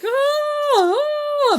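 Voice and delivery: high, loud wailing ah; agonized scream. Retold or imitated. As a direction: scream from inside the bowl. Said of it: "S O B is like".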